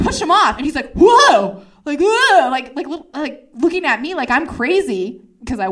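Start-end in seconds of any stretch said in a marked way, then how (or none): 0.9-1.6 loud, high startled exclamation; startled whoa. Retold or imitated. Man shoved off her, loudly startled. Man's shock at being pushed. 2.0-2.5 loud, high, taken-aback cry; startled whoa. Retold or imitated. Man looking at her like she's crazy, startled. Man's shock, repeated.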